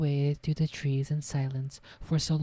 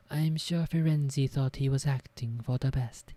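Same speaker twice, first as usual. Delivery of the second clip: ordinary delivery, nothing marked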